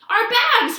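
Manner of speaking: high, loud alarmed cry; sudden realization. A narrator's reading. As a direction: Grover remembers the bags in alarm. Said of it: "Grover realized".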